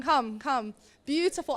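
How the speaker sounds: ordinary delivery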